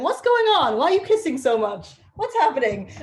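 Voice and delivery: high, loud, baffled doggy voice; loud comic high-pitched bewilderment; voicing a confused dog. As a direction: play an excitable dog demanding answers. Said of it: "my dog's just come out of his crate going".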